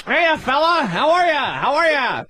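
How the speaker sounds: a square comic waving at the crowd, all cheer. Pretend.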